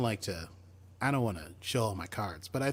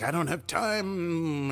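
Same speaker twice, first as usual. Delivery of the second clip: put-on Mudd voice; 5 semitones higher, much slower than usual. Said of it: "he's like".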